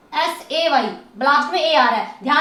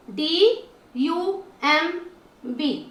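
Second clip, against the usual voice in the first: very slow spelling; much slower than usual. Shown as a demonstration. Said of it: "ध्यान से देखिए".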